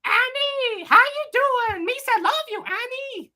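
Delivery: very high squeaky Gungan voice; Jar Jar voice. Retold or imitated. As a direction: pitch it way up like Jar Jar. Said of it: "Which Star Wars character is this".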